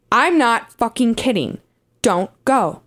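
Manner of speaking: ordinary delivery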